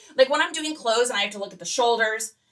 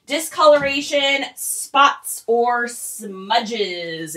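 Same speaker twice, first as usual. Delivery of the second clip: "Discoloration, spots" raised and louder, "or smudges" dropping slow; deliberate emphasis; 2 semitones higher, 3 dB louder, much slower than usual. Shown as a demonstration. Seller coaches viewers on wording, stressing "Discoloration, spots, or smudges" item by item. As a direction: punch out "Discoloration", "spots", then stretch "smudges". Repeats the preferred terms for emphasis.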